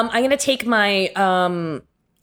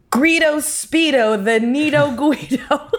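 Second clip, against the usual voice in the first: slow, higher announcement breaking into laughter; grand title; 5 semitones higher than usual. Acted. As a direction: proclaim the name like a title. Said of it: "naming the last friend".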